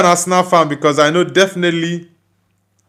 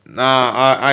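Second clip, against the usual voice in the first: ordinary talk, nothing marked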